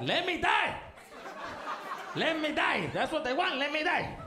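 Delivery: mock wailing, pitched high; dramatic drunk uncle. Drunk uncle refusing to come inside, mock wail. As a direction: whine it like a self-pitying drunk. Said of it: "I was like".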